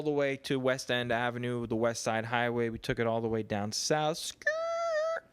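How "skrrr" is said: very high imitated skid noise; tire screech sound. Describing his city bike ride downtown, very high imitated tire skid. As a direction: make a bike skidding sound. Biking down to Tribeca.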